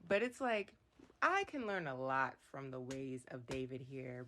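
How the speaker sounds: ordinary talk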